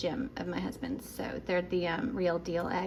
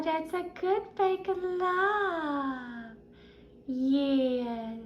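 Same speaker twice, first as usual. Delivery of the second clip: higher, slow cooing to the dog; pet talk; 5 semitones higher, much slower than usual. Real, not acted.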